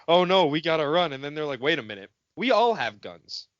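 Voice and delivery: normal talking voice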